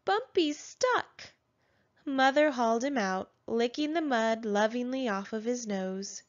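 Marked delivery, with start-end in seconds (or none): 0.0-1.3 high, slow little-dinosaur voice; piping baby voice, drawn out. A narrator's reading. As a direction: babies tell mother Bumpy is stuck, high slow baby voice. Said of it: "called the other baby dinosaurs".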